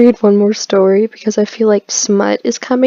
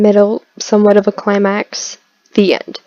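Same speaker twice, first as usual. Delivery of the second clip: normal talking voice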